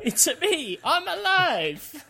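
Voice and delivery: very high-pitched cartoon catchphrase, stretched out at the end; hammed-up high falsetto cry; Mario. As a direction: squeal it like a game hero clinging to life. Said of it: "by saying Mario catchphrases".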